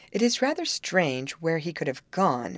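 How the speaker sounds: ordinary delivery, nothing marked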